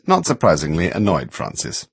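ordinary delivery, nothing marked

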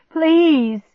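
high, loud plea; desperate begging. A narrator's reading. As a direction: beg the dog to move. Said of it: "I begged".

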